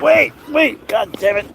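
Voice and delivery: high, loud panicked wait; terrified cry going down a slide; panicked yelling. Real, not acted. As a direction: shriek it in real fright.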